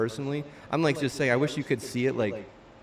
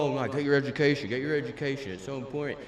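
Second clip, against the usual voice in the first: mocking quote; 3 dB louder than usual. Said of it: "But no".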